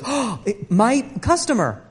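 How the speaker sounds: slick shopkeeper greeting, a touch of a laugh; oily salesman welcome; fawning clerk. Retold or imitated.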